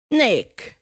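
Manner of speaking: loud boisterous greeting; hearty roar. Acted. Patrick greeting Nick, hearty roar. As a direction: bluff huntsman call. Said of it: "he roared".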